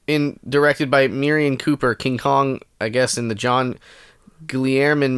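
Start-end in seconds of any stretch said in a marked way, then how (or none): none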